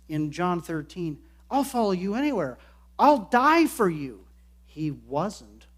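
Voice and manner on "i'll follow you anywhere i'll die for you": rash vow. Acted. Peter's rash vow retold.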